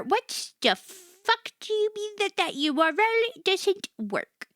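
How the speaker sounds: ordinary talk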